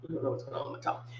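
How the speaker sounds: quick whispered aside. Real, not acted. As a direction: mutter it to yourself fast. Muttered aside.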